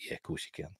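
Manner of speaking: whispered, mocking aside; hushed sarcastic jab. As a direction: breathe it out with mock disbelief.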